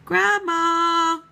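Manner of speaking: loud call for Grandma through the door; shouting Grandma from outside. Acted. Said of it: "she shouted".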